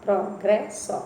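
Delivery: "Progresso" said slowly, syllable by syllable; slow syllable demo. Shown as a demonstration. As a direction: stretch out "Progresso" syllable by syllable for the class. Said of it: "também não tem encontro". ("Progresso" bem devagar, sílaba por sílaba)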